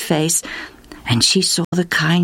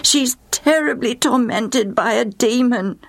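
higher pleading call, slowed on the key words; 8 semitones higher than usual. Acted. Mother calling out to Jesus for her daughter, pleading. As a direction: call across to Jesus, begging. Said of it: "she began to call out".

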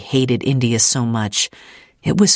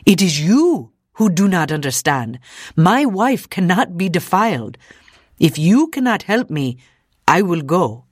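indignant refusal, slightly raised voice; understated yelling; 4 semitones higher than usual. A narrator's reading. Husband refuses to let his wife be defiled, mildly indignant. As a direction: stand firm with a touch of outrage. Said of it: "yelled the man".

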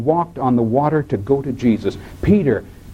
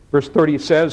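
ordinary talk, nothing marked

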